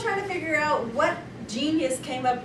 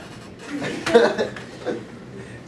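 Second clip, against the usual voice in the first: breathless laugh; 4 semitones lower than usual. Real, not acted. Laughing at own joke.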